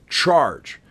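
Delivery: sudden loud battle cry; shouted order. Acted. Napoleon shouting the order to charge. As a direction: yell it like a general ordering the charge.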